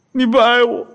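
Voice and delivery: high-pitched whining accusation; mock tearful wife. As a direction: wail it like a weepy wife, hammed up. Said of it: "然后哭了个半天". (模仿妻子带哭腔地抱怨)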